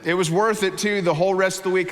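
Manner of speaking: normal talking voice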